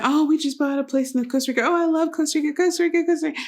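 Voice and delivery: high-pitched mock gushing; gushing travellers. Host mimicking people who love Costa Rica, high mock gush.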